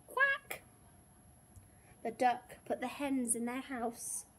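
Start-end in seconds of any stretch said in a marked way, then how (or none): 0.1-0.6 very high, loud quack; duck's quack. A narrator's reading. Duck answering the lazy farmer, high loud quack. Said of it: "And the duck answered".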